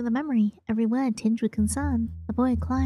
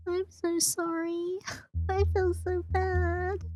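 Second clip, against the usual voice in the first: high, soft, slow sheepish apology; soft guilty laugh; 7 semitones higher, 5 dB quieter, much slower than usual. Real, not acted. Reader apologizes to character.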